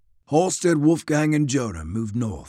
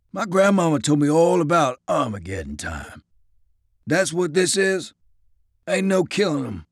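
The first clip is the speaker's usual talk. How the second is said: scornful Southern soldier; 6 semitones higher, 4 dB louder than usual. Acted. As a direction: scoff at the plan, bitter. Isaiah voiced, scornful.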